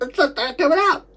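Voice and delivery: high, loud wail; real distressed high-pitched outcry; anguished reliving. Real, not acted. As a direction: cry it out after choking. Reliving choking in great distress.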